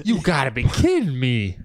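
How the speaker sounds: mock disbelief. Mock rapper banter.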